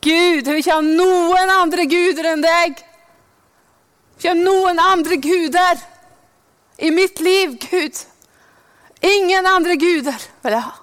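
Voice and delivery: preacher demonstrating a prayer against other gods; fervent prayer. Shown as a demonstration. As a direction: pray it out with full conviction. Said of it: "Så kan du be".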